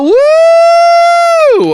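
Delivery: very high shouted woo; loud high party whoop; cheer. Acted. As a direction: whoop like the crowd just went wild. Cheering the water balloon fight.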